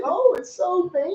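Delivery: high mocking voice; mock gross-out. Friends mocking the movie scene in high mock voices. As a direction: make fun of him in a high voice.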